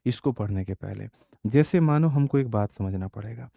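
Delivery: normal talking voice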